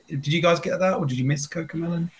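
usual speaking voice

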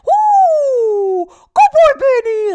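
long high whoop into loud falsetto praise; hammed-up imitation of a doting dog dad; Missus Doubtfire. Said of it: "he sounds like Missus Doubtfire".

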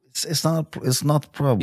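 broken English with a mock Russian accent. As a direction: play a comic Russian mobster. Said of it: "doing the Russian accent".

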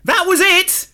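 high loud disbelief; incredulous shout. Real, not acted. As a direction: cry out in disbelief. Mocking the abrupt ending.

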